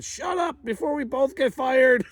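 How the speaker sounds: a grumpy lion hissing at a coworker; lion talking. Retold or imitated. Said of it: "And the lion said".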